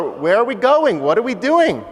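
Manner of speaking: put-on high, urgent child's questions; anxious boy. Boy asking his father in the street, anxiously. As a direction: plead for an answer, pitched well up.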